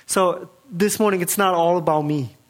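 ordinary delivery

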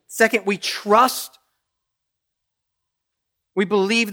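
loud, high, driving sermon delivery; full-force preaching. Acted. Pastor urging the church to trust, full-force preaching. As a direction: preach it hard to the congregation.

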